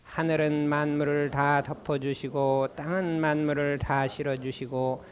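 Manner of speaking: slow, low, measured chant of a prayer; prayer recitation. Acted. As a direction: intone each phrase slowly like a liturgy. Leads a formal prayer recitation. (느리고 낮은 기도문 낭송)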